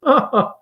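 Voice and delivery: high trilling hostess laugh; a hostess laughing at her guest; gushing laugh. Acted. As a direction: let out a bright high laugh. [name] laughs.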